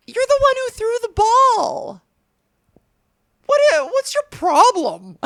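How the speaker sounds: high-pitched mock outrage; mock indignant protest. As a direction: squeak out the complaint at the pitcher. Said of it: "being like".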